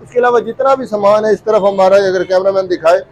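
upbeat presenter voice to camera; vlog host intro. Acted. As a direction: welcome the viewers like a YouTube host. Host's video intro to camera.

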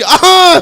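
a high, loud, full-force hawk; shouted throat-clearing. Retold or imitated. Uncle hawks at full force to open the window, shouted throat-clear.

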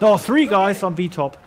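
loud, high warning shout; urgent callout. Real, not acted. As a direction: yell the enemy position fast. Warning team of enemies.

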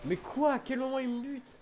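high, stunned outrage; indignant surprise. Real, not acted. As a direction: yell in disbelief. Shot out of nowhere. (surprise indignée, voix haute)

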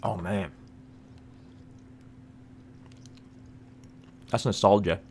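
talking between bites; chewing. Real, not acted. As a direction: mouth full of candy. Seen 1: Bites bar at -3, closed-mouth chewing throughout labelled words.

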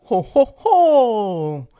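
booming, slow Santa ho ho ho; big jolly Father Christmas laugh. Acted. Christmas story opens with Santa.

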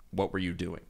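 low, very soft mock telling-off; understated imitation of an analyst laying into a ref; mild retold scolding. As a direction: deliver the rebuke softly and low. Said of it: "really lays into the ref".

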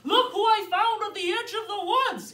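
loud, high bear call; storybook bear shouting; shouted. Acted. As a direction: shout the news to the whole family. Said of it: "he shouted".

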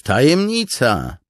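high, loud, slow gleeful word; delighted exclaim. Acted. Tall soldier relishing Kaladin's secret, high loud glee. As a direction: savour the mystery aloud. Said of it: "wykrzyknął wysoki żołnierz".

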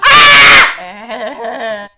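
sudden loud shriek; startled scream. Real, not acted. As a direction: scream out in shock.

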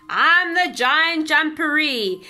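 big, slow, loud giant boom; booming monster voice. A narrator's reading. Unseen giant booming from the burrow, loud and slow. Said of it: "heard a loud voice".

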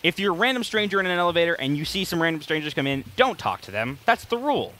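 ordinary talk, nothing marked

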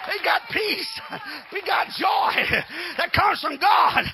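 loud, rising preacher's cry of victory; preaching at full force. Acted. Preacher to the congregation, fired-up preaching. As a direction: preach it out with fire to the church.

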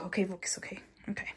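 whispered self-pep talk; whispering. Real, not acted. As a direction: whisper it to yourself to refocus. Calming herself down after venting.

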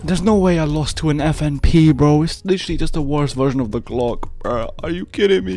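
loud, high-pitched disbelief; indignant outburst. Real, not acted. Gamer complaining about losing to a worse gun, loud indignation.

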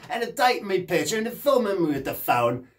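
ordinary talk

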